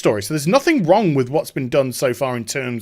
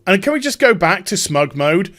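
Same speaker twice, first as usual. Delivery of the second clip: ordinary talk, nothing marked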